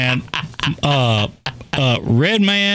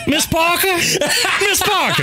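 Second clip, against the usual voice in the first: high calling voice; 13 semitones higher than usual. Retold or imitated. A movie fan calling Miss Parker high. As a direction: call her name up high.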